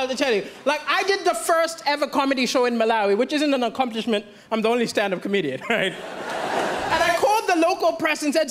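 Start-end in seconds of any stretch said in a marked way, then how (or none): none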